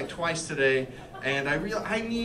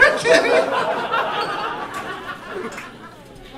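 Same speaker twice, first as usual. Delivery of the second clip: high, hammed-up sobs; mock crying; 3 dB louder than usual. Husband crying while doing squats, mock sobbing. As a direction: keep bawling wordlessly in falsetto. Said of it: "I would just cry while doing".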